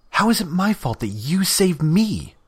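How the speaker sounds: high, loud disgusted cry; exasperated outburst. Acted. Scratch throwing up her hands at Damien in disgust. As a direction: fling the question at him in disgust. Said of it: "threw her hands in the air in disgust".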